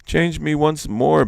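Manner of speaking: a lifted, loud plea to the sparrow; begging. Acted. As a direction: plead for another chance. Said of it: "begged Iktomi".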